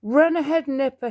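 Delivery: high, breathless plea while running; out of breath from running; panting. A narrator's reading. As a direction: gasp it out, winded. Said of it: "he panted".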